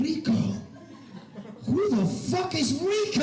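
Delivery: hammed-up jealous boyfriend; mock jealous outrage. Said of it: "I'd be behind her going".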